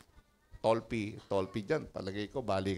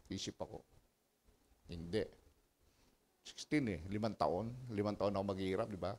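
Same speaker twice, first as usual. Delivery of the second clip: softer, lower inward musing; hushed retelling of praying over the car; quiet reflection; 4 semitones lower, 6 dB quieter than usual. Retold or imitated.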